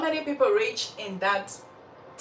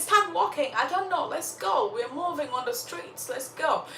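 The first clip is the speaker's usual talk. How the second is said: high-pitched mock scolding; acted impatient mother, voice raised in pitch; angry mother; 7 semitones higher than usual. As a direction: order the child along, pitched up without real yelling. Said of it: "because she's angry, she starts yelling at Sorrow".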